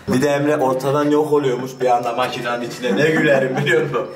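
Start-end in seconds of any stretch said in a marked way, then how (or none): none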